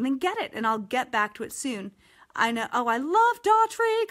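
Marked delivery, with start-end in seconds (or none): 2.7-4.1 high, slow, louder swooning; gushing. Real, not acted. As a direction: swoon over the singer. Gushing over a singer.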